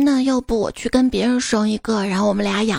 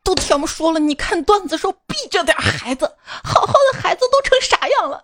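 mock roar; 5 semitones higher than usual. Husband scolding wife over the kid, hammed-up roar. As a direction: bellow it in hammed-up outrage, building to the end. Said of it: "咆哮道".

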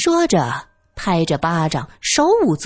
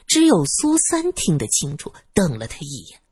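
ordinary delivery

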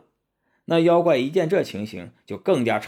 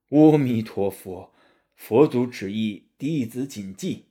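slow, solemn monk intonation; Tang Seng praying; slower than usual. Acted. Monk kneels to accept Buddha's decree, slow reverent prayer.

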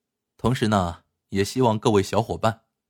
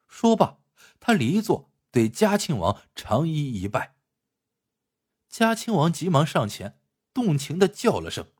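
higher, animated storyteller's voice; story narration; 6 semitones higher than usual. A narrator's reading. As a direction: narrate the tale with lively pitch swings. (评书式故事旁白)

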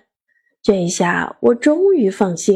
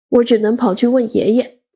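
plain reading voice

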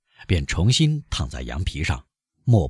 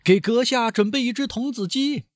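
calling out. A narrator's reading. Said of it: "放开喉咙喊".

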